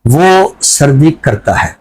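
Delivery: slow, measured reading of a free verse poem; poem recitation. Acted. Host asked for a poem, he recites it slowly. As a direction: let each line land with a pause.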